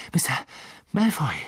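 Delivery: mildly breathless call; a touch of panting. A narrator's reading. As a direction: call out as if catching up. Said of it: "keuchte er".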